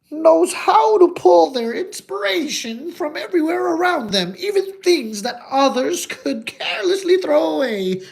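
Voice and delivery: loud, high-pitched put-on granny; grandmother's voice. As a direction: pipe up like a hammed-up grandmother. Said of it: "my grandma used to say".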